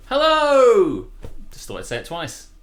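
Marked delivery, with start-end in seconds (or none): 0.1-1.1 much higher, louder, gushing hello; passionate hello. Shown as a demonstration. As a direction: throw real passion into the greeting. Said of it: "really passionate about the second hello".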